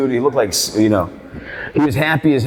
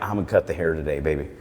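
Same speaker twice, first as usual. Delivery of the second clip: soft low reassurance; 7 semitones lower, 8 dB quieter, faster than usual. Retold or imitated. Soothing old client.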